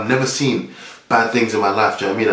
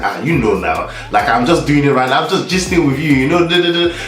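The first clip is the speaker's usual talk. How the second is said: natural Nigerian accent, rising higher; proper Nigerian accent; 4 semitones higher, 3 dB louder than usual. Shown as a demonstration. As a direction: gist easily in a rolling Nigerian accent. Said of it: "do a proper Nigerian accent".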